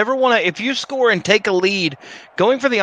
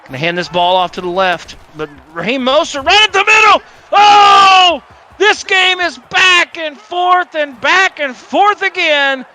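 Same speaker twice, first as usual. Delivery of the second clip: excited shout; 6 semitones higher, 6 dB louder than usual. Pretend. Mock play-by-play touchdown call.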